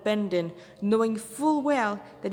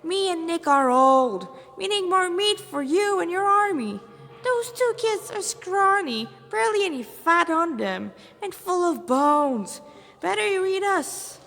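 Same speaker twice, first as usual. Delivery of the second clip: higher, louder laid-back Rasta voice; cocky Rasta hunter; 7 semitones higher, 5 dB louder than usual. Acted. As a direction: sell yourself to the demon with swagger.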